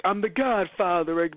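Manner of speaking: slow, put-on tough-guy voice; Joey's Godfather line. Imitating Joey in the dub, slow mock mobster. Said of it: "Joey's saying".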